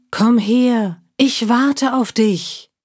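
high, slow luring call; eerie voice beckoning, slow; mysterious calling voice. Acted. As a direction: lure her in slowly. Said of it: "Ganz leise und deutlich rief sie ihren Namen".

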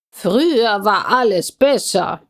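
put-on old man's grumble; crotchety grandfather voice; grumpy grandpa. A narrator's reading. Grumpy grandpa character butts in.

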